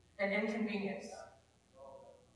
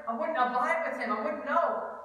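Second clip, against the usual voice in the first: ordinary delivery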